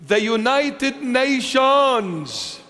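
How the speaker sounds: high, drawn-out mock announcement; mock-grand proclamation. Preacher ridicules UN working for peace, mock-grand proclaiming. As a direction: stretch it out with sarcastic pomp. Mocking the UN's claim of peacemaking.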